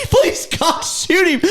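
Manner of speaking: desperate plea. Retold or imitated. Pleading with the shooters, desperate plea. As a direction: beg them to shoot. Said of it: "pleading during the hunt".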